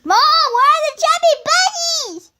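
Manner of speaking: high loud call to Mom. Acted. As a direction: yell it across the house. Calling out to mom.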